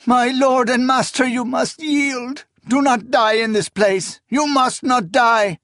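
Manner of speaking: wailing entreaty to a beloved master; sobbing plea. A narrator's reading. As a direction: plead desperately through acted sobs. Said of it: "she sobbed".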